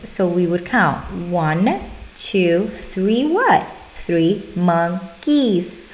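counting slowly, S drawn out on monkeys; stress the S. Shown as a demonstration. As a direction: stretch out the final S in "monkeys" as you count. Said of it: "we would emphasize the S".

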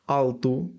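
Alto with final O said as U, alto-u; Portuguese alto. Shown as a demonstration. Teacher demonstrating Portuguese pronunciation, Alto ending in U. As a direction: end Alto on a clear U sound. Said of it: "В кінці читається як У".

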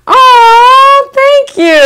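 high, gushing thank you; gushing high-pitched gratitude; touched squeal. Real, not acted. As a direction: squeal thanks like you're flattered. Touched by a viewer compliment.

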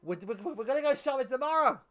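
high, loud, over-eager denial; acted-out hasty, high-pitched excuse; loud cover-up fib. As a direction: call it out to her, pitched high. Loudly fibs that freezer is empty.

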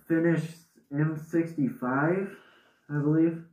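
muffled behind mask; speaking inside respirator; through a gas mask. Real, not acted.

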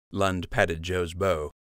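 ordinary delivery

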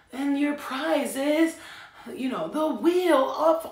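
slow, high, loud game-show hostess. Pretend. Playing a game-show hostess revealing a prize for Capricorns. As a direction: present the prize with showbiz flourish.

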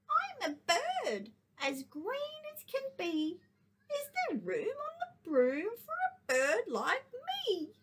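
high bird voice. Acted. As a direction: chirp it like a small bird. Teacher voices the green bird.

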